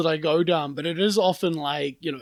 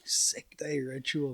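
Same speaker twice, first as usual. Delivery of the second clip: whispered; 4 semitones lower, 10 dB quieter, much slower than usual. Real, not acted. Repeating the question, musing.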